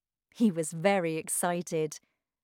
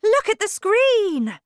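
high, loud, thrilled yell; excited shout; 10 dB louder than usual. A narrator's reading. Wonka alerting the parents to the screen, shouting. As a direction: shout to make everyone look. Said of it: "shouted Mister Wonka".

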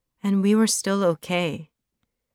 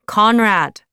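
calling his name, higher and louder; 4 semitones higher, 8 dB louder than usual. Acted. Belly calls Conrad again, this time louder. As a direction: call out so he finally hears. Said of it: "so I said it louder".

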